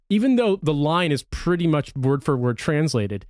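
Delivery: normal talking voice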